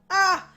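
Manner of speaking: high, loud startled "ah"; sudden exclamation. Real, not acted. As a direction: blurt a sudden cry on remembering. Suddenly remembers something.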